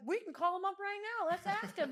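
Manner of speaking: high, loud eager insisting; insistent. Retold or imitated. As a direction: prove it to the cousins with eager excitement. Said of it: "I'm like".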